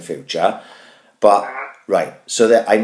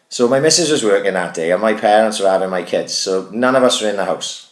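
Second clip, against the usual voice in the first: usual speaking voice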